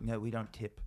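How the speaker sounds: put-on a self-admittedly weak Australian accent, said softly. Imitating the Australian girl he met at a club, in a weak Australian accent. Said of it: "I don't think I'm doing the Australian accent that well".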